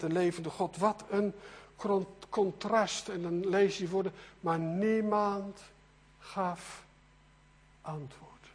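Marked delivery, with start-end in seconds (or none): 4.4-8.5 slow, falling away into softness; solemn, drawn-out. Retold or imitated. Preacher reads out that no god answered, slow and hushed at the end. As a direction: leave long pauses between the words, dropping in volume. Reads verse with dramatic pauses.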